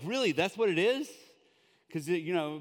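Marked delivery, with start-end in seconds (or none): 0.0-1.1 surprised. Retold or imitated. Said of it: "So my wife's like".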